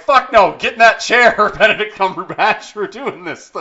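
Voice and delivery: loud, high mock director yell; Raimi shouting. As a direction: bark orders like a gung-ho director. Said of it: "and he's like".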